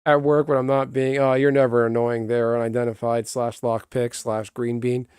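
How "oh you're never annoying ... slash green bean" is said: ordinary talk, nothing marked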